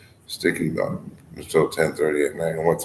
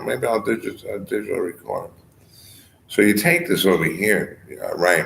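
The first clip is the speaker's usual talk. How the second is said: ordinary delivery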